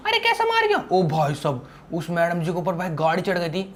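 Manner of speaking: ordinary talk, nothing marked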